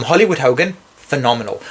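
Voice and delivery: normal talking voice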